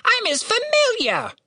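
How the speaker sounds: very high insistent squawk; demanding raven. A narrator's reading. Raven states its rank to Catherine, insistent squawk. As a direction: insist on the title as a bird. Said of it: "demanded Ravenous".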